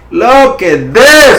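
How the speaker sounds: thrilled, stretched-out look at this; excited. Real, not acted. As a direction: savour it like a win you called. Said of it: "I get so excited".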